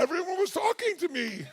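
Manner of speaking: high, teasing echo of the partner; mock flustered apology. Husband mimicking his wife's excuse, mock flustered.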